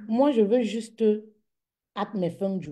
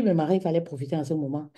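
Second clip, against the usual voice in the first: ordinary talk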